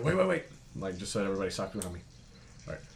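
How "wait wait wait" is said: urgent call. Retold or imitated. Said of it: "I said".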